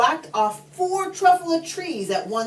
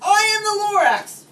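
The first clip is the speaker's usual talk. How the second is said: loud, higher proud announcement; Lorax proclaiming; 12 semitones higher, 6 dB louder than usual. A narrator's reading. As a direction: boom out his name to the Onceler. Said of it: "came back with more gripes".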